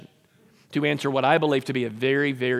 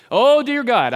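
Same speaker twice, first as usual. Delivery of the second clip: high, loud mock appeal to God; mock pleading prayer; 8 semitones higher, 8 dB louder, slower than usual. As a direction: play up a needy prayer. Mimics a self-centred prayer.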